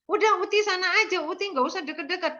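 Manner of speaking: put-on high, quick child's voice shooing Grandma off; child pushing away. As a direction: rush it like a scared kid telling Grandma to go away. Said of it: "Terus dia bilang gini". (suara anak kecil yang cepat dan tinggi)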